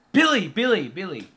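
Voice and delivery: loud, high shout of a name, dropping each time; calling out loud. Retold or imitated. Searching for the boy, calling out loud. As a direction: yell the name as if searching for someone.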